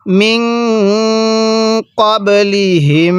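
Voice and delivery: মিন ক্বাবলিহিম with long humming nasal endings; measured model reading of মিন ক্বাবলিহিম; slow tajweed recitation. Shown as a demonstration. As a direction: hold the n and final m of মিন ক্বাবলিহিম. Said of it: "কিভাবে পড়ব?".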